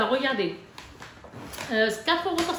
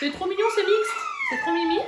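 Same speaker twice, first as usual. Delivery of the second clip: gushing. Real, not acted. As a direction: squeal over how cute it is. Gushing over baby bib.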